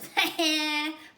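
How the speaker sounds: loud mocking classmate laugh; jeering laugh. Classmate laughing at Calvin, loud jeering laugh. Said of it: "Laughed Terrence".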